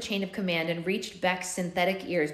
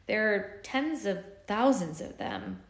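blunt; 2 semitones higher, slower than usual. A narrator's reading. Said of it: "Blue said bluntly".

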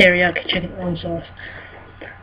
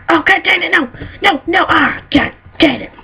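frustrated outburst; 11 semitones higher than usual. Real, not acted. Gamer yelling in frustration after failing the jump. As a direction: yell at the failed jump.

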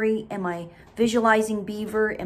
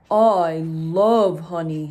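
very slow, louder, low bear; 3 semitones lower, 7 dB louder, much slower than usual. Acted. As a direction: savour the word honey. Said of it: "said Bear".

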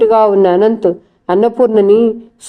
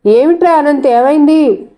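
alarmed cry; 6 semitones higher than usual. A narrator's reading. Mother rushing in, alarmed at her son. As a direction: call out in alarm as you run in.

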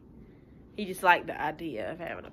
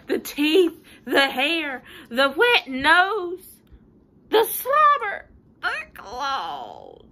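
high and loud, each item more alarmed; panicky list; 7 semitones higher, 8 dB louder, much slower than usual. Acted. Pigeon listing what scares it about the puppy, rising panic. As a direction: climb into a squeal at slobber.

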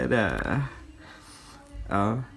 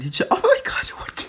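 stunned whisper; 11 semitones higher, much faster than usual. Real, not acted. Stunned by the photo card.

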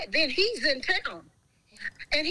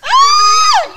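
a big shrieking burst of laughter; loud shrieking laugh; 19 dB louder than usual. Real, not acted. She bursts out in a loud shrieking laugh. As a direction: crack up at full volume. Howling at the car story.

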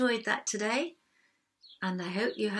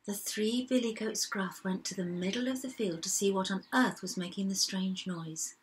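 usual speaking voice

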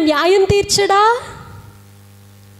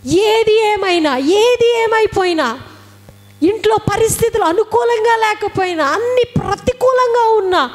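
fervent exhortation; 4 semitones higher than usual. Real, not acted. Preacher exhorts congregation.

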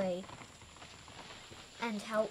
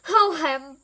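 higher, loud call; 5 semitones higher, 11 dB louder than usual. A narrator's reading. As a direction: call after someone leaving. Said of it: "called Wilbur".

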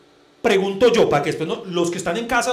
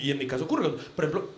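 normal talking voice

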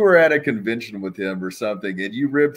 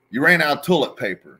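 louder, higher, fast mock Sags; Sags's blunt excuse; 6 semitones higher, 6 dB louder, faster than usual. Sags coming out of the bathroom, blaming her. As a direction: toss it off like it's her fault. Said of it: "he's like".

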